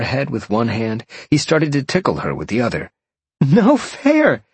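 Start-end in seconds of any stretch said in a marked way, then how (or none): 3.4-4.4 high, loud playful squeal; laughing protest. A narrator's reading. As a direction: cry out in mock protest while being tickled. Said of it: "Jolene laughed".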